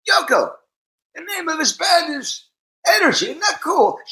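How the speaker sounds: very high, delighted call across to a partner; excited high-pitched mimicry; lit-up excitement. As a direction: imitate someone thrilled by a discovery. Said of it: "And he lights up".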